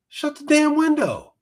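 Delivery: high-pitched, fed-up shout; pleading yell of frustration; exasperated yell. Real, not acted. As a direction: bark it at the screen in disbelief. Yelling at the Karen on screen.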